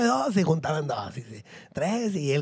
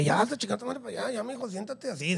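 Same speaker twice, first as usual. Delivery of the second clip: muttered scolding; 11 dB quieter than usual. Retold or imitated. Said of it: "le dije".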